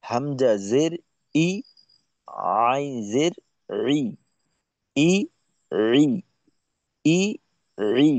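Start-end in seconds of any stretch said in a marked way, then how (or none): 2.7-8.1 রি pressed from mid-throat, ই light and plain; throat sound ain. Shown as a demonstration. Teacher showing the throat letter to a student, pressed throat sound. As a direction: squeeze রি from the middle of the throat. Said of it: "গলার মাঝখানে".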